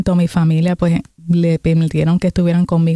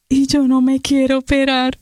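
higher, whiny mock-crying protest; retold tearful plea; 5 semitones higher than usual. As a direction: plead as if about to cry, voice held high. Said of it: "rompí a llorar".

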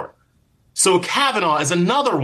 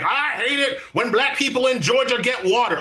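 higher-pitched, put-on politician's rant; hammed-up campaign-trail declaration in a higher voice; mock stump speech; 6 semitones higher than usual. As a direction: caricature a hateful stump speaker, pitched up. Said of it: "get out on the stump and be like".

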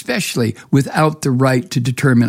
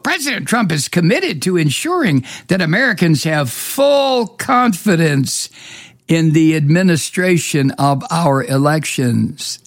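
higher, drawn-out, sing-song official statement; slow mocking quote; 4 semitones higher than usual. Host quoting spokeswoman's statement that makes him gag, slow and mocking. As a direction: read it slowly with exaggerated disdain. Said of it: "I have to say that slow".

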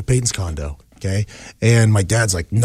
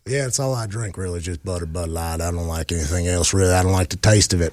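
low, mellow Peyton; 9 semitones lower, 4 dB quieter than usual. Retold or imitated. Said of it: "And Peyton goes".

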